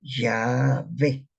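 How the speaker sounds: Yahvé drawn out, accent on the final E; Yahvé in two long syllables, stressed at the end. Shown as a demonstration. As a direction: stretch Yahvé and land on the E. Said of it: "la acentuación la lleva en la E".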